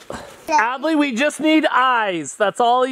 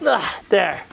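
ordinary talk

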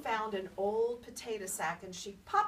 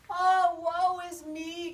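high, loud, slow wail of woe; old Gram crying out her misery; Gram's lament; 8 semitones higher, 10 dB louder, slower than usual. Acted. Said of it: "cried Gram".

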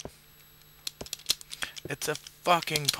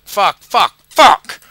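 rising into a loud, high curse; real gamer rage; frustrated shout; 7 semitones higher, 13 dB louder, much slower than usual. Real, not acted. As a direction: let the anger build to a yell. Missed jump rage.

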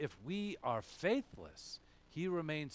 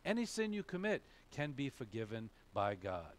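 usual speaking voice

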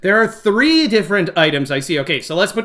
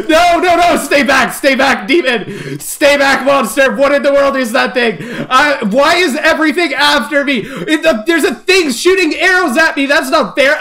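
high, frantic warding-off shouts; alarmed, indignant outburst; panicked yelling; 6 semitones higher, 4 dB louder than usual. Real, not acted. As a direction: yell at monsters to stay back. Fending off monsters.

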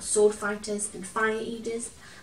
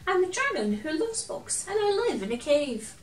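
friendly dragon voice; 8 semitones higher, 5 dB louder than usual. Acted.

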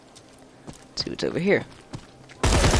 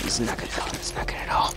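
fearful whisper; 4 semitones lower, 9 dB quieter, faster than usual. Real, not acted. Whispered dread in fight.

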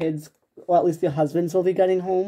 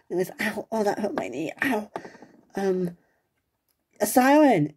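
ordinary talk, nothing marked

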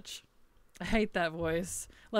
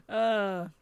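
ordinary talk, nothing marked

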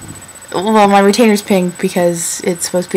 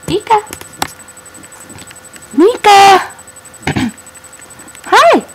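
calling out; 10 semitones higher, 4 dB louder than usual. Real, not acted. Calling the cat.

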